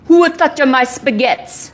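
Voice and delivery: mock mobster demanding answers; gangster voice. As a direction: pretend gangster outrage. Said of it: "lines to say in my gangster voice".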